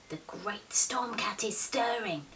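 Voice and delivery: slow, breathless whisper of a worried cat. Acted. Mouser at her window, thinking aloud in a slow whisper. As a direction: hush it out, slow and unvoiced, as if afraid to wake the storm.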